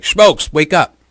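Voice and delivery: high, loud wake-up yell; retold yell. [name] yelling at sleeping Schmokes, loud high shout. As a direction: bellow him awake from sleep.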